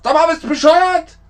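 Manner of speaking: loud, high, fast mock outburst; hammed-up shout at another motorist; road rage. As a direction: yell like an exasperated driver. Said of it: "Im Straßenverkehr sagt man oft".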